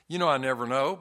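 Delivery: ordinary talk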